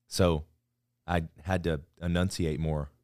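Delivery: ordinary talk, nothing marked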